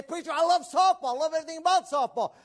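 loud, high, gushing fan; playfully acted-out enthusiasm; imitated enthusiast. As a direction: gush like a devoted fan. Voices an enthusiastic church member.

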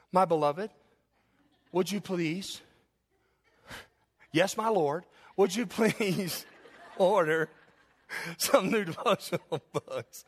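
slow, sugary, laughing through it, imitating his wife; mock-sweet wife asking nicely, laughing; sweet wife impression. Preacher imitates his wife's sweet request, slow and giggling. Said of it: "Okay, let me try it again".

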